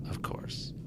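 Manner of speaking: breathy, fading whisper; whispered resignation. Real, not acted. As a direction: sigh it out under your breath.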